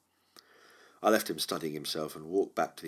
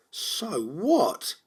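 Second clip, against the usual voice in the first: higher, curt dismissive snap; a short higher snap of contempt; snapped remark; 12 semitones higher, 7 dB louder than usual. Acted. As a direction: brush it off sharply. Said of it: "his snapped remark".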